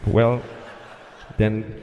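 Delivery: low, halting tower clearance with long gaps; hesitant controller. Retold or imitated. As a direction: reluctantly drag out the radio clearance. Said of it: "That's how he said it".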